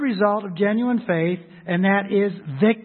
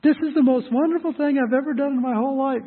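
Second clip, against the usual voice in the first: higher, overdone fake enthusiasm; mock gushing; 8 semitones higher, 3 dB louder than usual. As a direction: gush like you don't mean a word. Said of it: "You're a hypocrite if you say".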